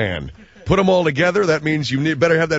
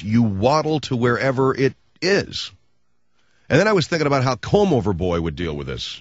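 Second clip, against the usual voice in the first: usual speaking voice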